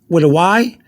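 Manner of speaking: ordinary delivery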